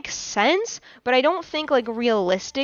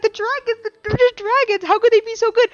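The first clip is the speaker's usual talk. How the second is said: much higher, fast mock indignation; outraged fan voice; 10 semitones higher, faster than usual. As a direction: play the betrayed fan, squeaky and fast. Voicing outraged Warrior Cats fans.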